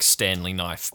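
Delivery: ordinary delivery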